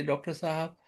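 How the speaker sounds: soft, low-energy moan to the doctor; a weak patient groaning for help; moaning plea. Shown as a demonstration. Patient moaning softly to his doctor, a mild moan. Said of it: "वो मोन कर रहा है".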